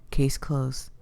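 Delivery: soft final whisper. Acted. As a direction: close the case in a hushed whisper. Grim closing line.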